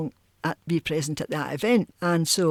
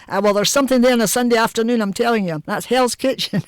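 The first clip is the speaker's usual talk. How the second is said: mock-cross mother, louder and higher; lightly acted raised-voice telling-off; retold screaming; 5 semitones higher, 5 dB louder than usual. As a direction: insist firmly, imitating a scolding mother. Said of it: "she would be screaming at him".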